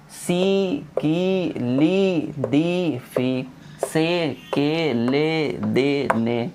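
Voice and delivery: सी, की, ली then से, के, ले, every vowel held; drawn out. Shown as a demonstration. Teacher running the drill again, syllables drawn out. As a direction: stretch each syllable from सी to ने evenly. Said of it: "पढ़ेंगे खींच के".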